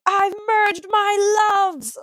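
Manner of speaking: high, slow, drawn-out ecstatic cry; gleeful exclamation. Retold or imitated. Actor recalling singing with the understudies, high and ecstatic. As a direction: sing it out like a triumphant discovery. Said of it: "I was like".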